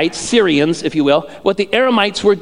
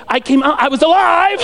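higher, laughing burst of excitement; excited announcement; 7 semitones higher than usual. Acted. Jesus excitedly jogging someone's memory, excited announcement. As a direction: blurt it out with amazed joy.

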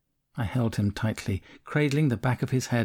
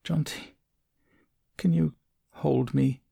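put-on soft like a sick child; 4 semitones higher than usual. A narrator's reading. Oti asks Jonty to hold him, lightly voiced sick child. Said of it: "His voice was soft, like a sick child".

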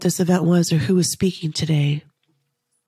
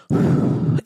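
long, heavy breath out; emotional sigh. Real, not acted. Speaker pauses, overcome by her own story, heavy emotional sigh. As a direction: exhale hard to steady yourself. Steadying herself while retelling.

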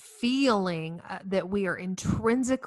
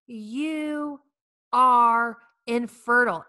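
"You are infertile" slow, louder, falling heavily on "are"; grave verdict; 4 semitones higher, 6 dB louder, much slower than usual. Shown as a demonstration. Doctor pronouncing her infertile, grave and heavy. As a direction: hand down the diagnosis like a sentence. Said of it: "when I was diagnosed".

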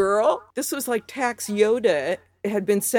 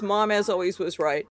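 usual speaking voice

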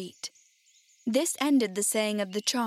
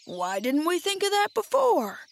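higher-pitched exclaimed question; storybook exclamation, pitch raised; crying out; 7 semitones higher than usual. A narrator's reading. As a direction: call it out as the Scarecrow struck by an idea. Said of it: "cried the Scarecrow".

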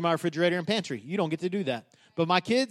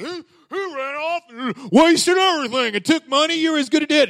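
higher, indignant complaint, lightly acted; indignant older son; 7 semitones higher, 3 dB louder than usual. Preacher voices the resentful older son complaining to his father. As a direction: voice the jealous brother's grievance. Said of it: "He's like".

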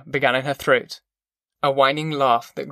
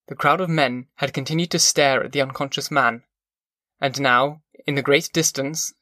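plain reading voice

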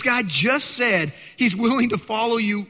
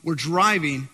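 ordinary delivery

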